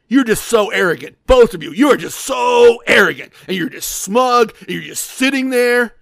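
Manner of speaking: loud mock-angry accusation; imitating anger. Losing gambler scolding two winning players, retold anger. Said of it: "he's getting angrier and angrier".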